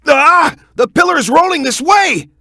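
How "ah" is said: startled yelp. Acted. Pillar rolling at them.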